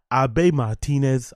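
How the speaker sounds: Abe said as two syllables, "ah-BAY", drawn out; slow Abe Martinez with Abe as "ah-bay"; African pronunciation. Shown as a demonstration. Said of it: "I give it an African pronunciation".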